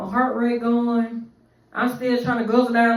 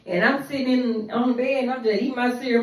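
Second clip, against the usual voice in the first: ordinary talk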